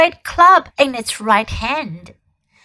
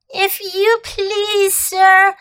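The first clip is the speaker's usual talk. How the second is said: high, trembling and slow; barely able to speak for fear; 7 semitones higher, slower than usual. A narrator's reading. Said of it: "trembling so that he could hardly speak".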